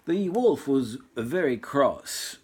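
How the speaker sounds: plain reading voice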